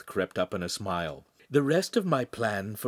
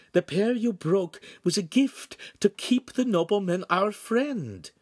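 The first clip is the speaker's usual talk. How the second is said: higher, gently pleading woman; lightly voiced signora; 10 semitones higher than usual. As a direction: coax the boy kindly but urgently. Signora soothing the boy.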